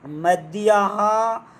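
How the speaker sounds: मध्याह्न drawn out slowly; very slow dictation. Shown as a demonstration. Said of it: "इसको लिखते हैं हम".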